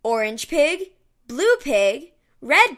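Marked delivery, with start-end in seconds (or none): none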